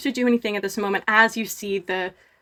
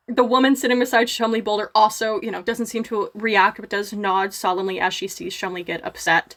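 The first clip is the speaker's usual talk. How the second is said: ordinary talk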